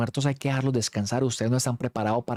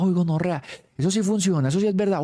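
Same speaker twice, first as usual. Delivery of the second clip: excited hype; 7 semitones higher than usual. Retold or imitated. Friend hyping the ghost program.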